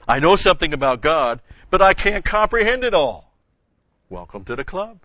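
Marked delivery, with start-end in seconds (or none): 0.1-3.3 the psalmist's words, louder and rising much higher; voicing the psalmist. Preacher voices the psalmist's awe to the church, loud and high. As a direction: cry out in awe, straining upward. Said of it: "What's the psalmist saying here?".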